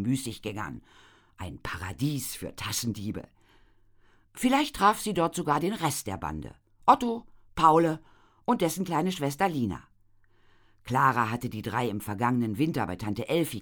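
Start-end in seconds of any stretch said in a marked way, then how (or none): none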